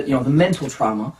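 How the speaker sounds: usual speaking voice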